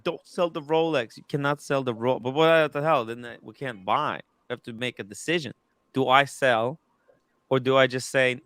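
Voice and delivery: normal talking voice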